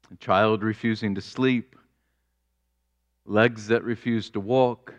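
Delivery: ordinary delivery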